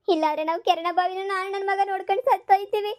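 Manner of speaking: a mother fakes despair to get a house; melodramatic wail. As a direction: overplay the despair to win pity. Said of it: "ಏನ್ ನಾಟಕ ಮಾಡ್ತೀಯಾ?".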